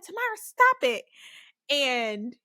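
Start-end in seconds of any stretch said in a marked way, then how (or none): 0.0-1.1 loud, high imitation of a sister snapping; fed-up older sister. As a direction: imitate a sister at the end of her patience. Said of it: "So then she got fed up".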